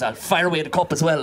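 usual speaking voice

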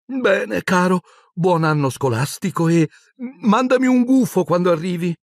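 high-pitched motherly farewell; high, fussing maternal voice; doting mother. A narrator's reading. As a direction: send a dear son off fondly. Doting mother says goodbye to son.

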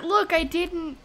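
ordinary talk, nothing marked

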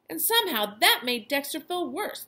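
usual speaking voice

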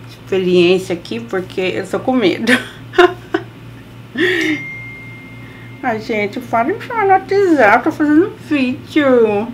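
talking through clenched teeth; hair clip in teeth. Real, not acted. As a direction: lips parted around clip. Seen 0: Long clip held between teeth throughout while styling her hair.

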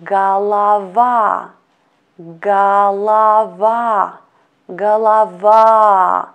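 Голова slow, with the final A stressed, ga-la-VA; stress on last A. Shown as a demonstration. Teacher slowly modelling Голова, stressed on the final A. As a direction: draw out Голова and land on the last A. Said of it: "Stress to last letter A".

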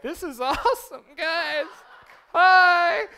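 high, loud mock excitement; hammed-up giddy cheer, laughing, ending on a big loud "Hi"; gleeful photographers. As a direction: imitate overexcited photographers calling out. Mimics excited photographers at war.

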